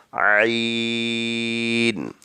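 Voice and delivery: عین slowly with a changed throaty voice, unlike ہمزہ; ain from the throat. Shown as a demonstration. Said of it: "آواز change کر کے پڑھیں گے".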